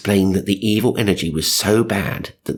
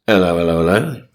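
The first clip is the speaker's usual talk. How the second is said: 1 comedy policeman; 4 dB louder than usual. Retold or imitated. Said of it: "he said".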